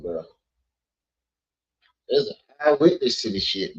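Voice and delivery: normal talking voice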